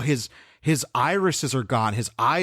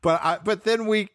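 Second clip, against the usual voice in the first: ordinary talk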